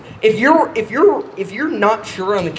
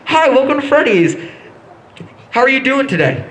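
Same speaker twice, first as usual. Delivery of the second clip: cheerful cashier; 4 semitones higher than usual. Retold or imitated. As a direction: chirp the welcome like a friendly cashier. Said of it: "what it looks like from her point of view".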